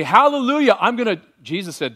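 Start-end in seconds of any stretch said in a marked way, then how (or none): 0.0-0.8 a bright, raised praise cry; a joyful praise word; joyful hallelujah. Shown as a demonstration. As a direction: say it gladly, lifting the voice.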